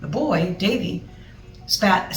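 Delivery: plain reading voice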